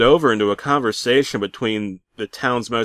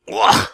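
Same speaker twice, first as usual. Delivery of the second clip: wince groan; 5 dB louder than usual. Acted. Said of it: "I winced".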